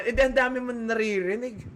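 ordinary talk, nothing marked

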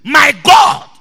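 loud proclamation; Daniel's loud reply. Acted. Daniel calling to the king from the den, loud. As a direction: shout it up to the king. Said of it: "Daniel said to the king".

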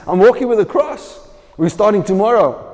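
high, loud, determined declaration; defiant announcement. Retold or imitated. Man on his sickbed answering his upset family, high and defiant. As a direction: insist you're going, no matter what.

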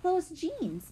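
high, emphatic discovery; declared. A narrator's reading. Violet recognizing the torn jeans, declaring it. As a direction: announce a sudden realization, high. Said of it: "Violet declared".

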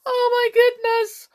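very high, loud tearful squeal; weeping. A narrator's reading. Hop threatened by the king, weeping. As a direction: wail it right at the top of the voice. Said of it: "wept Hop again".

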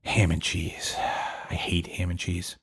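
low, deflated disgust; fed up. Acted. American worker opens his lunchbox, fed up. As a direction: say it slowly and softly, sick of it.

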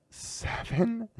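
very high astonished echo; amazed. Real, not acted. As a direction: squeak it back as a question. Amazed echo of child's answer.

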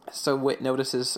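ordinary talk

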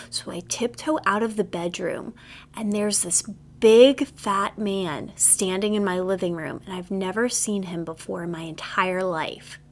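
normal talking voice

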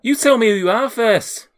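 high, loud demand; shouting door guard. Acted. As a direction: demand an answer through the door. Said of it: "a shouting voice".

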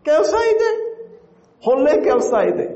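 called out high, as if across a field; calling from far away. Shown as a demonstration. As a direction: call it out to someone far off. Kannada phrase said twice, called out.

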